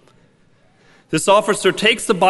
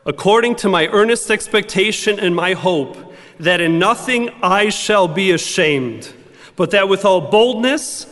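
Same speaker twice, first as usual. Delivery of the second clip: loud, soaring scripture proclamation; preaching at full force; 4 semitones higher, 3 dB louder than usual. Acted. Preacher proclaiming Paul's words to the church, loud and bold. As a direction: proclaim it boldly to the whole church.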